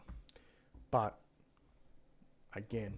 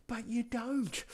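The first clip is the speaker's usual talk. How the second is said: imitating Amelia; 13 semitones higher, 3 dB louder, slower than usual. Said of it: "She goes".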